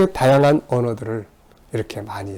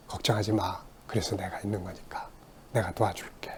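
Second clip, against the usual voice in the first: hushed, much softer reassuring words; soft reassurance; 4 semitones lower, 13 dB quieter than usual. Retold or imitated. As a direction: comfort them almost in a whisper. Comforting a struggling person. (속삭이듯 다정하게 안심시키며)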